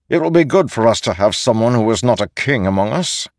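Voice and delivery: lightly voiced advocate, pressing the point briskly; insistent Nale. Nale arguing for a second chance, insistent. Narrator voices a story figure.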